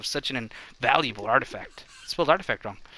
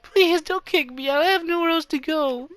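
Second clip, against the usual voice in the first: pleading character; 13 semitones higher than usual. Acted. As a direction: beg in a squeaky put-on voice. Character begs not to leave.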